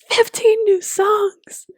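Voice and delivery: high, slow squealing announcement; excited squeal. Retold or imitated. Friend texting about new songs, high excited squeal. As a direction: squeal it out like breaking news.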